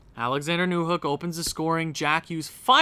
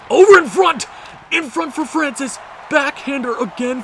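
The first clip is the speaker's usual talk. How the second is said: high, loud burst calling the chance; excited play-by-play call; 9 semitones higher, 7 dB louder than usual. Real, not acted. Fan doing play-by-play of a scoring chance, loud and excited. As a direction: call the scramble in front.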